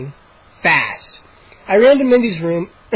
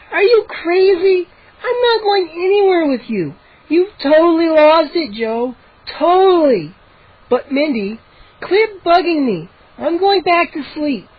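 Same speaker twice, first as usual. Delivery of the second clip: put-on much higher, whiny girl voice; annoyed sister voice; 10 semitones higher than usual. A narrator's reading. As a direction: brush him off, half-asleep and cross. Sister rebuffs brother in a put-on voice.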